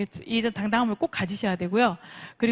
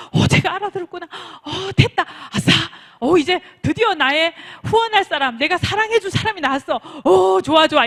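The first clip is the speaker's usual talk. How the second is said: high, gleeful self-congratulation; mock elation; 6 semitones higher, 6 dB louder than usual. Coach acting delight that a prospect understood, excited. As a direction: gush with hammed-up triumph.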